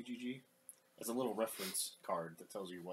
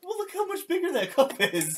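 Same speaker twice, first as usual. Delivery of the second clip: much higher, louder amazed exclaim; excited exclaim; 16 dB louder than usual. Real, not acted. Joking about big cup.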